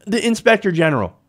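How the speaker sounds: high-pitched mock protest; mimicked complaint. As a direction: imitate a whiny complainer, pitched well up. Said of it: "And now you're saying".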